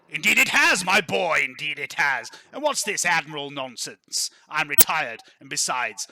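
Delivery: put-on loud, hearty aristocrat greeting an old friend; boisterous lord, jovial. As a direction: boom it out, easing mid-speech. Game master voices jovial Laurent.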